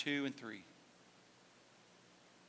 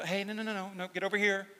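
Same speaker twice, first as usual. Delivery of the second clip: gentle coaxing; 4 semitones higher, faster than usual. Retold or imitated. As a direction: coax the sheep back gently. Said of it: "the staff was more gentle".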